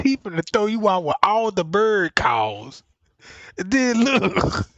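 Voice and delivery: ordinary delivery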